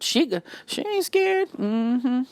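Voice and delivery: high, slow, soft sassy put-on voice; urban woman. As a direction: draw it out high with sass. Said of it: "That's a real urban woman".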